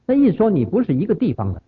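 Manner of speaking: usual speaking voice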